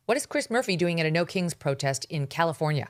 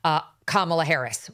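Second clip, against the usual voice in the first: ordinary talk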